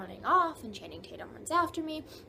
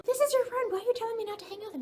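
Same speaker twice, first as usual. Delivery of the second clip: high, loud and fast angry outburst; indignant shout at the jealous friend, high, loud and fast; angry retort; 11 semitones higher, 4 dB louder, much faster than usual. Retold or imitated. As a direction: snap back at him high, loud and fast. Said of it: "And I get all angry".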